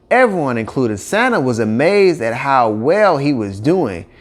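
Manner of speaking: usual speaking voice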